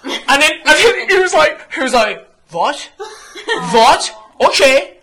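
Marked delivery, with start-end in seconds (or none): 2.5-5.0 high, loud, indignant Iranian suitor; imitated Iranian guy. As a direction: play a rebuffed Iranian flirt, pitched up. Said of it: "this fresh Iranian guy".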